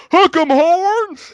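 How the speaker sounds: loud, high, drawn-out Texan holler; slow Southern stadium shout; Southern yell. Retold or imitated. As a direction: yell it like a Texas football fan. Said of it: "I just yell out".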